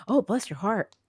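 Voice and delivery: sugary fake pity with a rising oh; syrupy sweet, faintly condescending; honeyed Southern put-down; mock sweet sympathy. Shown as a demonstration. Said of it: "as in".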